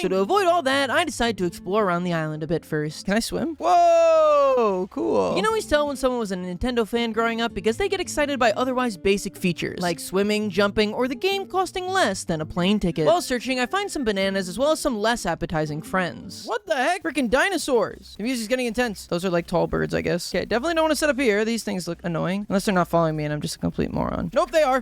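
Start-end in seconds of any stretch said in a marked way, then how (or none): 3.6-5.3 surprised. Real, not acted. First swim in game. 24.3-24.8 alarmed. Real, not acted. Dinosaurs chasing him.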